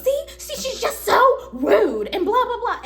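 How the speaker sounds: high, slow, mocking complaint with laughter; imitating the customer tattling about the clerk; mock whiny customer. Customer to coworker, high mocking whine.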